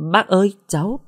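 put-on a girl stunned by her boyfriend's mother; stunned stammer. A narrator's reading. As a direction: stammer it out, caught off guard. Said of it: "Uyển ngỡ ngàng".

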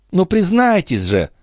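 high-pitched coaxing demand; insisting, with a high pleading lift; insistent. A narrator's reading. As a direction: press for a confession. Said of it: "настаивала графиня".

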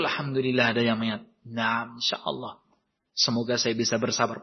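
quiet gratitude. Acted. Grateful reply to reminder.

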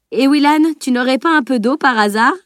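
amused teasing question, voice lifted high; chuckling. A narrator's reading. Old master teasing Willan, chuckling. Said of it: "gloussa-t-il".

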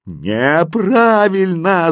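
loud, high, stretched yell; drawn-out roaring rejection; bellowing. A narrator's reading. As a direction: bawl the verdict syllable by syllable. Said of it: "заорали знаки".

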